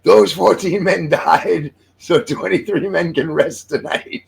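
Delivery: high, slow, solemn mock English accent; a bad English accent delivering a pompous punchline. The leader solemnly justifies the losses in a bad English accent. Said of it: "I'm gonna do an English accent".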